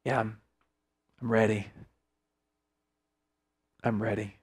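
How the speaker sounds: low, soft, halting resolve; hushed, sincere commitment with long pauses; quiet surrender. Acted. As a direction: drop right down and answer God simply. Voices a surrendered answer to God.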